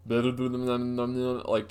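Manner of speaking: imitating her mumbling nonsense under her breath; mumbled gibberish. Retelling the homeless customer's talk, mimicked mumbling. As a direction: slur a few blurry sounds like a muttering stranger. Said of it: "Sounded like that".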